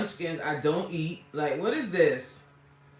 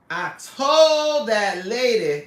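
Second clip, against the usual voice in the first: slow, drawn-out, louder mock wail; milk it like a put-upon diva; hammed-up complaining repeat; whiny dramatic wail; 4 semitones higher, 8 dB louder, much slower than usual. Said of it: "repeats line in new voice".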